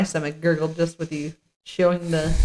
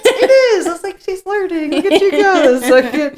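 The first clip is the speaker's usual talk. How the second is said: laughing; 11 semitones higher, 5 dB louder than usual. Retold or imitated. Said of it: "Is that a Cable Guy reference?".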